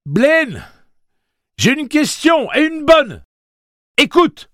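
appel crié. A narrator's reading. Suzanne crie vers le micro.

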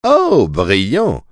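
slow, drawn-out exclamation; exclaiming. A narrator's reading. Fumalo reacts to Susanna's guess, exclaiming slowly. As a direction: draw out "brillant" as an exclamation. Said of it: "s'exclama Fumalo".

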